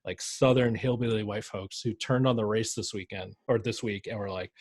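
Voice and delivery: ordinary talk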